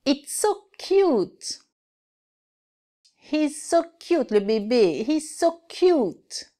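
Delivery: high, slow, breathy coo over something adorable; cooing cute. Acted. Teacher acting out cooing at a cute baby for students.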